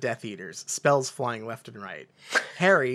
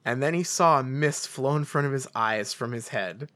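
ordinary talk, nothing marked

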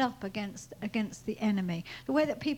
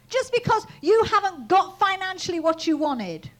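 loud, raised-pitch scolding; outraged, forceful dressing-down; retold indignant rebuke; 7 semitones higher, 6 dB louder than usual. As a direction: re-enact telling off a friend, hammering it out. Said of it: "I could talk to him like that".

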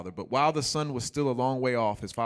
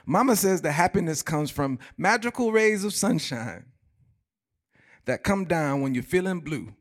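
higher, louder eager Waterboy; Waterboy's earnest answer; 7 semitones higher, 6 dB louder than usual. Retold or imitated. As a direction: stand up and recite mama's wisdom proudly. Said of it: "The water boy stands up and he says".

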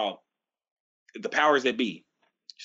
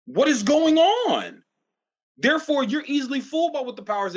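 ranting emphasis; 9 semitones higher, 4 dB louder than usual. Real, not acted. Ranting at America.

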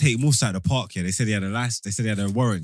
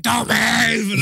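sharp shout, higher and louder; retold officer's shout; 9 semitones higher, 4 dB louder than usual. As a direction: bark the order like a nervous cop.

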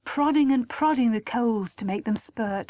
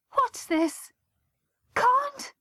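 shocked disbelief; much slower than usual. A narrator's reading. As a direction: voice leaping much higher. Mother hears shocking news.

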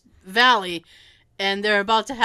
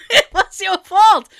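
laughing; 8 semitones higher, 9 dB louder than usual. Real, not acted. Laughing accusation.

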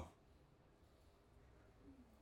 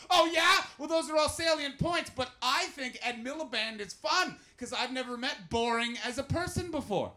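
mock loud retort; 8 semitones higher, 5 dB louder than usual. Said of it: "You're like".

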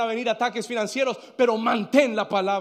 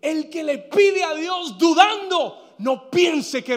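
slow, raised preacher's voice; emphatic scripture warning; 6 semitones higher, 5 dB louder, slower than usual. Real, not acted. Preacher quoting scripture to those who doubt, emphatic warning. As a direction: lift it high and stretch the warning.

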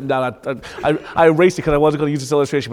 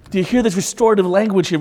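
ordinary talk

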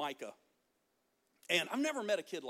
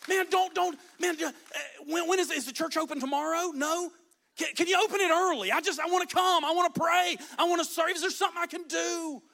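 loud, high, stumbling over the words; eager pleading; 7 semitones higher, 6 dB louder than usual. Acted. Churchgoer asks to get in early and serve, loud eager pleading. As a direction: pester urgently, barely holding it in.

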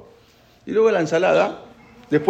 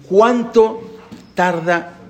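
drawn out; 4 semitones higher, much slower than usual. Real, not acted. Very slow emphasis.

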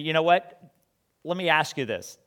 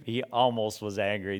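ordinary talk

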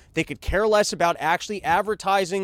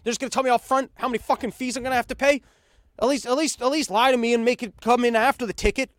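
indignant rant, pitched higher and quicker; mock outrage; 6 semitones higher, faster than usual. Venting at Ticketmaster over hidden fees, mock outrage. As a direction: sneer at the ticket company in disbelief. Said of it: "I was like".

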